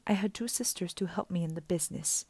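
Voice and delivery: soft secretive whisper. Acted. Auntie Em whispering to the kids about her lost sisters. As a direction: hush it, as if mourning.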